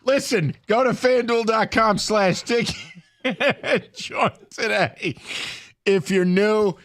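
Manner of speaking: ad read. Acted. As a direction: push the promo with a laugh. Reads a sponsor ad.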